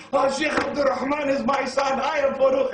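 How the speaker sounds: loud, high-pitched proclamation; excited screaming. Retold or imitated. Overjoyed father screaming the news about his son, excited. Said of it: "He comes out the house screaming".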